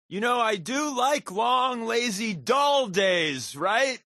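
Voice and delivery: high, slow sarcastic moan; sarcastic whine. Acted. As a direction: stretch it out in mock longing for boredom. Sarcastic high drawn-out complaint.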